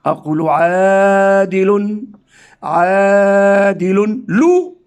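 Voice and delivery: عادل stretched slowly with a final n; slow reading with nunation. Shown as a demonstration. Teacher repeating عادل slowly for pupils. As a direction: draw out عادل, ending in un. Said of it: "عندما أرى ضمة ثانية".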